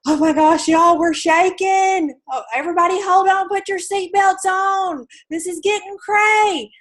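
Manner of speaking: high, loud, frantic pilot on the intercom; panicking pilot. Retold or imitated. Pilot on the intercom panicking at passengers, high and loud. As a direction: play a pilot losing it over the turbulence. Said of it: "and he's like".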